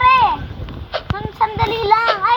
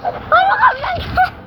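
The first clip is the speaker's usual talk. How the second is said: surprised shout; 11 semitones higher, much slower than usual. Real, not acted. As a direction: yell it in disbelief.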